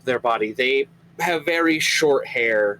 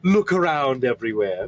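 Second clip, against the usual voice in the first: eager host; 2 semitones higher than usual. Acted. Gorla offers a grotto tour.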